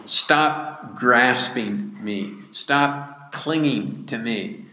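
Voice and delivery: slow, deliberate firm command. Acted. Jesus commands Mary to stop clinging, slow and firm. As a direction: lay each word down like an order. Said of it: "states in the imperative, that is, it's a command".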